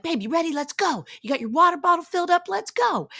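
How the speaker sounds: high, fast, excited urging; high-pitched excited nagging to get going; eager hurry. Retold or imitated. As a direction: rush him out the door, buzzing. Rushing husband to the gym.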